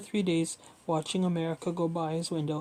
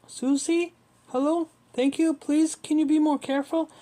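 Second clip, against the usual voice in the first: high, loud, anxious calling out; panicked plea; 9 semitones higher, 6 dB louder than usual. A narrator's reading. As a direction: plead loudly in a raised, panicky pitch. Panicked father pleads with Italian dockhand.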